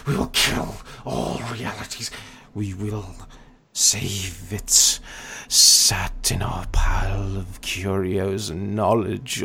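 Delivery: whispery, then a sudden mad shout; unhinged dragon, whisper then roar. Acted. GM voices the dragon.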